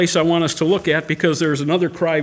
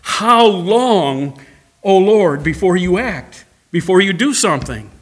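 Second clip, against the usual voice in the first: anguished cry; 5 dB louder, slower than usual. Acted. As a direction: shout it up to heaven, begging. They're crying out.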